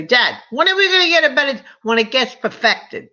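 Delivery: put-on high, quick, eager kid's question; a child pestering her father, high and fast; childhood plea to dad. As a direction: ask like an impatient little girl. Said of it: "I used to say".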